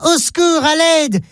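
high, mock-panicked cry for help from a window; a lightly voiced child calling for help. A narrator's reading. Child at burning building window, high mock cry for help.

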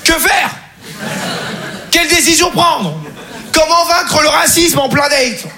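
high, slow dramatic declamation; mock-tragic dilemma. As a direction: agonise grandly, drawing the words out.

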